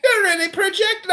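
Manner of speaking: high, fast leprechaun voice. Pretend. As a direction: squeak it quickly up high. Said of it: "He does sound like a leprechaun".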